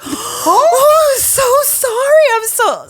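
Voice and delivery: very high, loud mock wail; squealed over-the-top remorse; horrified apology. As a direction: blurt a frantic apology, hammed up. Bride horrified, apologising, retold.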